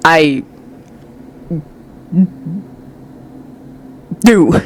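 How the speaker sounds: stiff, halting admission; awkward, stilted confession. A narrator's reading. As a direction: force it out stiffly with long pauses. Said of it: "Sasuke says stiffly".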